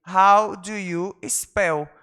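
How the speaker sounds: very slow, drawn-out model sentence; slow model phrase. Shown as a demonstration. Teacher modelling for students, very slow. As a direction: spell it out slowly for the class. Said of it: "teacher models phrase slowly".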